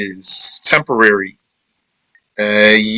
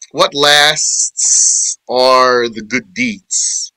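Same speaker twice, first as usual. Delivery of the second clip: slow, deliberate gloss of the verse; slow explaining voice; slow translation; much slower than usual. Acted. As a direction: lay each word down slowly.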